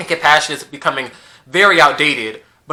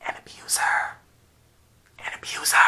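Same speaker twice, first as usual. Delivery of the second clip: whisper; 13 dB quieter than usual. Pretend. Eerie answer to meme question.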